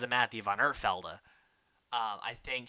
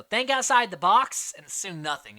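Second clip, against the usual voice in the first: loud, raised mock instruction; imitated advice; 5 semitones higher, 8 dB louder than usual. Castaway advises his tribemate, mock-emphatic and loud. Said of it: "Jason tells her".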